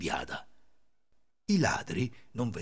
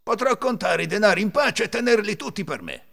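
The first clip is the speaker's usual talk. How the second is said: loud, high self-satisfied thief; thief's voice; 10 semitones higher, 6 dB louder than usual. A narrator's reading. Thief left alone with the money, loud satisfaction. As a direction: declare it to yourself aloud. Ladro parla tra sé.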